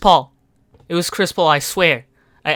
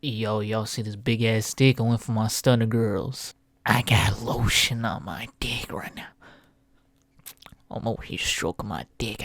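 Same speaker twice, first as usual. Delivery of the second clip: creepy low murmur; 9 semitones lower, 6 dB quieter than usual. Acted.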